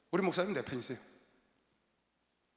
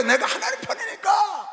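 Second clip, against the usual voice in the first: triumphant shout; 10 semitones higher, slower than usual. Acted. Climax of sermon point.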